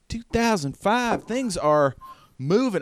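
high Southern character voice; accidental Southern accent. Acted. Eddie marvelling at the year, high voice turned Southern. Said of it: "he's from the South".

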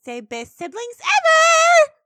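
high, loud, drawn-out squeal; Pinkie Pie. Retold or imitated. Pony snapping a photo of siblings, gleeful shriek. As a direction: stretch it out into an overexcited shout. Impersonates Pinkie taking pictures.

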